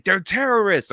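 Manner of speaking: high mock yell of outrage; hammed-up, high-pitched accusation; imitated screaming. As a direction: mimic a host ranting at the camera. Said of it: "He's just screaming".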